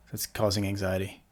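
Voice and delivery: calm, softly settling meditation voice with long pauses; guided meditation. Real, not acted. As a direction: guide them gently into the body, leaving space to breathe.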